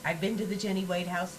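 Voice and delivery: usual speaking voice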